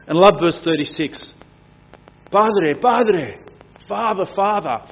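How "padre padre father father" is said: intimate cry to Dad. Acted. Jesus calling his Father.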